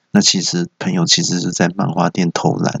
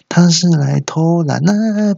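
high, drawn-out phony client; put-on, stretched high voice; fake client voice; 9 semitones higher, slower than usual. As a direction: disguise your voice as a client greeting a manager. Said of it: "还扮演客户".

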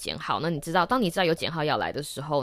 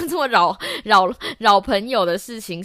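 laughing; 5 semitones higher, 9 dB louder than usual. Real, not acted. Laughs at bugging friends.

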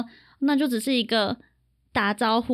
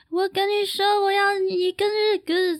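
foreigner's Mandarin; 7 semitones higher than usual. Retold or imitated. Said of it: "可能就像外国人讲中文".

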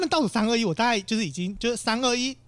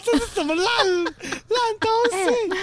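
laughing exasperation; 13 semitones higher, slower than usual. Retold or imitated. Retelling her reply to the strangers' plan, laughing and exasperated. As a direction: laugh at how ridiculous it is.

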